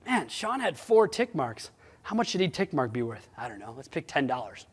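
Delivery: musing to himself. Retold or imitated. Manager pricing each tick mark, softly musing to himself. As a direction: think it over under your breath. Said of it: "I'd say".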